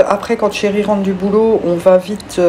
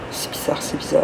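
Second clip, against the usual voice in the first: breathy puzzled whisper; 3 semitones lower, 9 dB quieter than usual. Real, not acted. Repeats puzzled remark in whisper.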